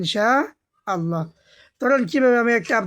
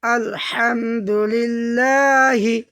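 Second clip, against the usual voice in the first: আলহামদুলিল্লাহি recited very slowly, syllable by syllable; drawn-out recitation; slow Quranic recitation; much slower than usual. Shown as a demonstration. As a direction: chant আলহামদুলিল্লাহি slowly like tajwid practice. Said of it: "দ্বিতীয়বার উচ্চারণ করবে এইভাবেই".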